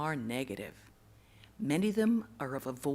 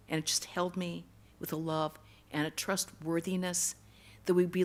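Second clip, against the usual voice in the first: usual speaking voice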